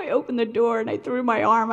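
ordinary talk

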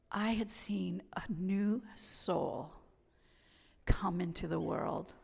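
slow hushed whisper of wonder; awed whisper. Real, not acted. Telling listeners about the newborn, an awed slow whisper. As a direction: breathe it out slowly in awe. Awe after witnessing a birth.

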